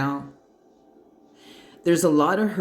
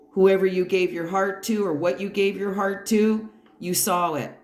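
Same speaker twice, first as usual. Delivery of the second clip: ordinary talk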